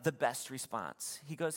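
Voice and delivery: ordinary talk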